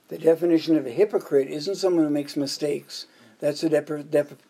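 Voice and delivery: normal talking voice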